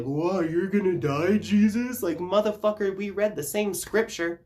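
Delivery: high, mock-surprised voice; mock their fake surprise; mock shocked apostles. Apostles acting shocked that Jesus will die, mock surprise. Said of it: "The apostles are all sitting there like".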